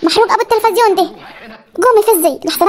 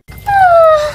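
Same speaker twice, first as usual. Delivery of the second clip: sleepy groan; 11 semitones higher, much slower than usual. Acted. Daughter dragged up for suhoor.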